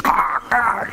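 high choked gasping sounds; mock choking gasps. Comedian retelling his child choking him, gasping high. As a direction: gasp out like a man being throttled. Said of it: "He's like".

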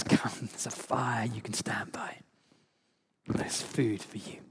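soft slow whisper as Jesus. Retold or imitated. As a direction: breathe it gently as Jesus inviting. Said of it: "And he says".

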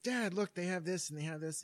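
put-on high, loud little kid calling to Dad; imitating an overexcited child; excited kid, too loud. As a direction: blurt it out with no volume control. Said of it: "not controlling the volume of his voice".

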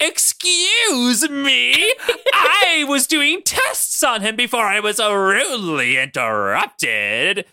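high, drawn-out indignant villain; Arcade. Acted. Arcade walks out protesting the interruption, indignant. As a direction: stretch it out, hammed-up affront. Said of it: "Arcade walks out and he's like".

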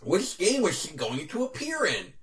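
high, slow nerdy questioner; nerd voice. Acted. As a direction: ask it as a drawn-out, high-pitched nerd. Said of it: "I'll do my nerd voice".

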